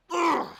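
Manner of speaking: loud, high exaggerated ugh; mock disgusted groan. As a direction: gasp of fake outrage.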